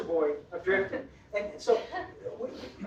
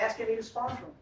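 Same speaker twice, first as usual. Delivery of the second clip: normal talking voice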